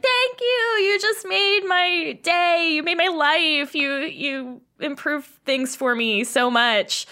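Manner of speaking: put-on high, slow gushing child's voice; happy kid. Kid thanking the sellers, high gushing child voice. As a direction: gush with childlike gratitude. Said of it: "They're like".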